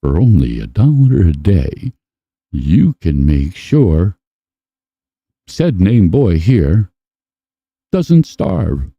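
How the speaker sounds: mock the sombre charity narrator; charity ad voiceover. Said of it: "the voice comes on".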